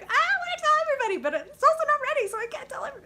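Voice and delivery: high, loud, rushed squeal; bursting with excitement. Acted. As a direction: blurt it out, unable to keep it in. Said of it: "something that I'm really excited about".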